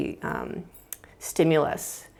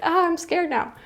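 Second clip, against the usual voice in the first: mock scared toddler; 12 semitones higher than usual. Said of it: "they might start crying".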